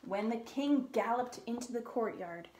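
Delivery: ordinary delivery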